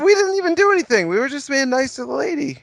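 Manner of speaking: high-pitched mock protest; indignant. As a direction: play the wrongly accused gentleman. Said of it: "we'll be very indignant".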